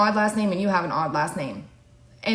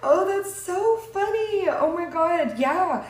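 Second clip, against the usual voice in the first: amused; 10 semitones higher, slower than usual. Real, not acted. Amused reaction, high pitch.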